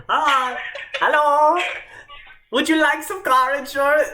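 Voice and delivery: put-on high-pitched Indian accent. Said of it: "don't do an Indian accent very well".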